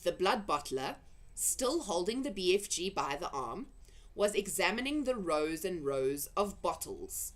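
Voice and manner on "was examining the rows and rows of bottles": plain reading voice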